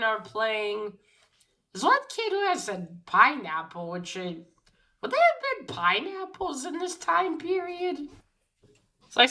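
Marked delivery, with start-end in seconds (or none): 5.0-8.1 high-pitched, incredulous wondering; baffled aside, pitched way up; puzzled questioning. Real, not acted. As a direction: question it in a much higher voice. Puzzled aside about an anachronism.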